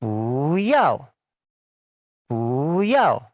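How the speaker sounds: "Bu yao" with bu rising; second-tone bu. Shown as a demonstration. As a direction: lift "Bu" then fall on "yao". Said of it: "dirangkai menjadi gini".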